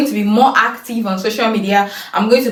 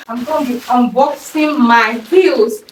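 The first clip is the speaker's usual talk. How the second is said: ordinary talk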